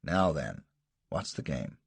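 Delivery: plain reading voice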